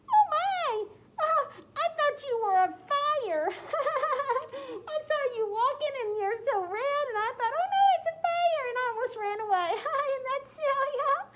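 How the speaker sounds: very high, loud and giggly; lamb character voice. Acted. Bouncy lamb laughing at red Tom, high giggly loud voice. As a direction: squeal it like a bouncy little lamb.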